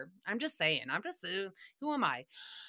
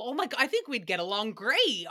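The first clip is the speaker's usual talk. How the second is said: ordinary talk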